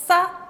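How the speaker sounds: "sa" with pressure built up on the s; pressed tense s. Shown as a demonstration. Teacher showing the pressure needed, tense "sa". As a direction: squeeze the s of "sa" hard. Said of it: "tengo que hacer una presión para poder pronunciar".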